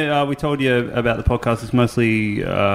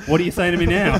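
mock confrontational challenge, pitched up; higher, jokey put-on fight-starter; train station aggressor; 6 semitones higher than usual. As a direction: lightly imitate someone picking a fight. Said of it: "he pulled that train station fight shit".

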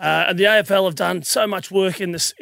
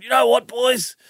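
higher-pitched mock pep talk, amused; retold locker-room rev-up, pitched up; rallying team talk; 5 semitones higher than usual. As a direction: imitate a teammate geeing up the boys, half laughing. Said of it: "we had the big team talk".

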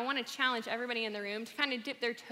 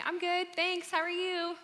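bright, higher put-on fine voice; fake cheerful reply; 7 semitones higher than usual. Telling a friend she's fine, bright and put-on. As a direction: sound fine while hiding it.

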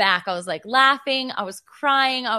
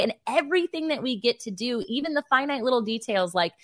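ordinary talk

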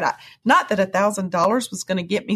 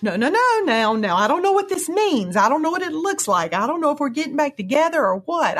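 husband reassuring; 6 semitones higher than usual. Retold or imitated. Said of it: "Ron's like".

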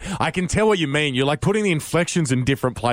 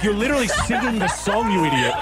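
laughing; 8 semitones higher than usual. Retold or imitated. Retold thought, laughing.

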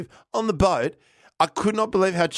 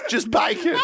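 high-pitched, cracking up on the words; laughing disbelief. Real, not acted. Telling of the bacon plate, high laughing disbelief. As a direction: let amazement break into laughter.

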